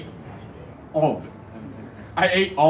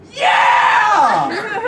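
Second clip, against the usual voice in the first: high, drawn-out, full-out scream; retold all-out yell; loud scream; 8 dB louder than usual. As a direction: scream it as loud as you can. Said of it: "I scream so loud".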